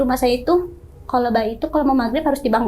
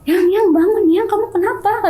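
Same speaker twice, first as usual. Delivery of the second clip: higher, louder urgent calling; worried wake-up call; 7 semitones higher, 4 dB louder than usual. Retold or imitated. Husband waking his crying wife, alarmed call. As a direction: shake her awake in alarm.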